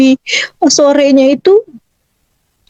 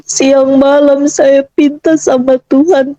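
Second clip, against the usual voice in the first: usual speaking voice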